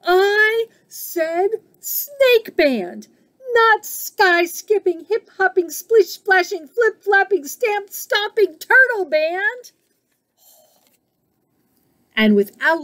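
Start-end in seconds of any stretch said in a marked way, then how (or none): 0.0-3.1 high, very slow insistent yell; exasperated shout. A narrator's reading. Snake shouts down the musicians, high exasperated yell. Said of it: "shouted Snake". 3.5-9.7 exasperated shout. A narrator's reading. Snake rejects every noisy band, high exasperated shout. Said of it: "shouted Snake".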